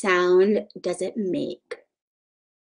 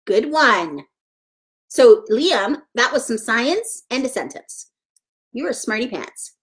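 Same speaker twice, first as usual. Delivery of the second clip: ordinary talk, nothing marked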